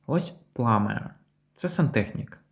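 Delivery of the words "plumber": "plumber" said PLUM-uh, no B; silent B. Shown as a demonstration. Teacher gives first example word, silent B. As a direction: say "plumber" with only M in the middle. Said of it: "ми не вимовляємо букву Б".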